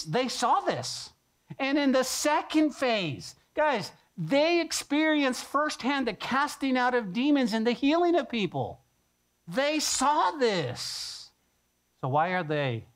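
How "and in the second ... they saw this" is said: higher, slow and drawn out; preaching slowly to a climax; building emphasis. Real, not acted. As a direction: stretch out every word.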